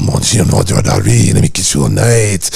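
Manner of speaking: put-on low, slow French-accented lover voice; hammy French accent, deep and slow; Pepe Le Pew. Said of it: "little Pepe Le Pew".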